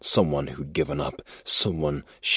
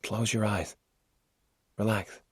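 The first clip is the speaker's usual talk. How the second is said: soft, high, soothing voice; calm him down gently; 6 semitones higher, 5 dB quieter than usual. Acted. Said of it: "he said in a soothing tone".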